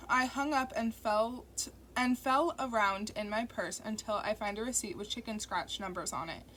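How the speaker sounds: ordinary delivery, nothing marked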